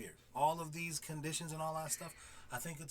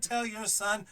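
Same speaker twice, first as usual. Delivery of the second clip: ordinary delivery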